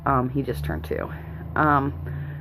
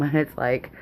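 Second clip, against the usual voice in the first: normal talking voice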